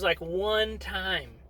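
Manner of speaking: ordinary delivery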